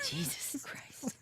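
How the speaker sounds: breathless whispered curse; horrified, say it under your breath; whispered shock. Real, not acted. Appalled by the joke.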